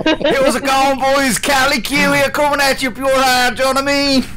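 put-on Dublin accent. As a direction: Dublin accent sliding into Limerick. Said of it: "I started out Dublin and morphed into Limerick there".

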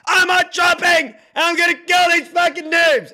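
high, loud hyped-up shout; reckless battle yell. Retold or imitated. Streamer acting out own hot drop, yelling wildly. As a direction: scream it like an overexcited gamer charging in. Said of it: "Where me, I say".

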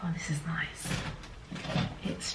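hushed breathy aside; whispered. Real, not acted. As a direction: whisper it to yourself. Admiring hoodie to herself.

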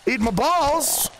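cheeky taunt. Pretend.

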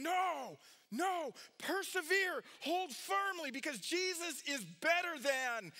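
high, slow, stretched-out pleading call; urgent exhortation. Acted. Preacher voicing the writer urging persecuted believers, urgent exhortation. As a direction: urge them hard to hold on. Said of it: "And he's saying".

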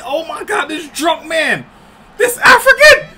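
high, loud, laughing yells; ecstatic shout. Real, not acted. Hyping the winning fighter with laughing shouts. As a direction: scream in amazed disbelief.

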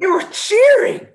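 loud, very high squealed exclamation; ecstatic shout. Acted. Musician to bandmate about the crowd, ecstatic. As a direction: yell it, over the moon. Bandmates celebrating after their show.

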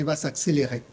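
ordinary talk, nothing marked

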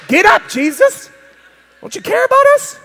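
desperate plea over the storm; panicked disciple. Retold or imitated. Disciple waking Jesus in the storm, panicked. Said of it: "one of us said".

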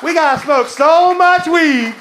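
rally the room with a stretched-out holler; rallying shout. Acted. Comic rallies the club crowd to smoke weed, slow rousing shout.

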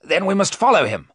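loud, eager high cry; cried out. Acted. Arthur crying out to his friends, loud. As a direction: urge them on, almost shouting. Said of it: "Arthur cried".

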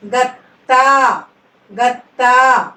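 గత్తా said very slowly with త్త held and pressed; గత్తా drawn out, double త్త stressed hard; pressed double letter. Shown as a demonstration. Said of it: "వాటిని నొక్కి పలకాలి".

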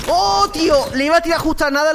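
high, loud groan of frustration; frustrated outburst. Real, not acted. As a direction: shout it out, annoyed at the near miss. Just missed a kill.

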